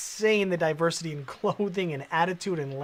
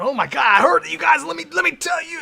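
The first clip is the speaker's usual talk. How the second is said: high, loud, frantic mock shouting; mock freaked-out chatter; 11 semitones higher, 10 dB louder than usual. Mocking the angry chatter in a frantic high shout. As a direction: ham up a hysterical commenter.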